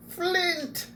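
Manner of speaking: high delighted shout of a name; joyful kender cry. Acted. Kender greeting his dwarf friend, high and joyful. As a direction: call out to an old friend, thrilled.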